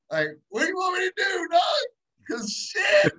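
high, fast near-shout begging for an answer; exasperated plea to God. Retold or imitated. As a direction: throw the question up at the sky, voice cracking. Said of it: "and I'm like".

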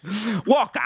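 high-pitched hammy villain call; scenery-chewing villain. Retold or imitated. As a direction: imitate an overacting movie villain calling out.

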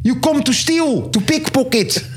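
very high-pitched accusing English; pickpocket catcher. Acted. Thief catcher confronting pickpockets in Amsterdam, high mock accusation. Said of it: "Naar het pickpocket team".

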